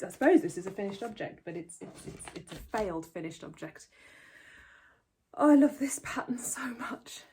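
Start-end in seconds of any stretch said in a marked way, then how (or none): 5.3-7.2 gushing whisper. Real, not acted. Gushing over favourite sweater.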